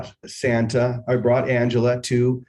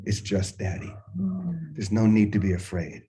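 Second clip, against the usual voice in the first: soft, low and calming; gentle reassurance; 3 semitones lower, 6 dB quieter than usual. Retold or imitated. Daughter relaxes as Daddy reassures her, soft and soothing. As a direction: hush a child's fear with a quiet, lowered voice.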